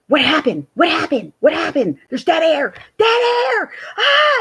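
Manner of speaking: rising frantic cries of alarm; panicked crew yelling over dead air; mock panic. Radio crew in a mock panic over dead air, frantic.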